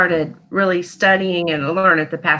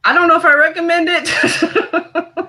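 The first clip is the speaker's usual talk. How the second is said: high-pitched, amused caveat; giggly and pitched up; laughing; 9 semitones higher, 3 dB louder than usual. Real, not acted. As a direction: say it breaking into laughter. Joking after scary story.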